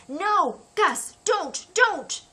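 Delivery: high, loud panicked plea; boy begging his brother to stay back; frightened pleading. Acted. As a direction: stop him, voice rising. Louis stops brother approaching bear.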